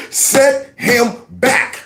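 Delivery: very slow, drawn-out sarcastic echo; slow mocking parrot of his words; mocking repeat. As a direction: stretch each word out to ridicule it. Mocking the man's claim.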